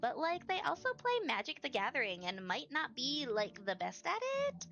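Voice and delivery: put-on bubbly Valley Girl voice; gushing Valley Girl read; Valley Girl accent. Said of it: "Do you like my Valley Girl accent?".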